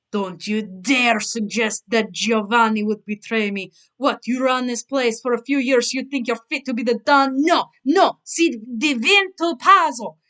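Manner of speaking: put-on furious mob father, heavy Italian accent; thickened Italian accent. Father rages at his son in a thick Italian accent. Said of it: "that Italian drawl in his words thickened up".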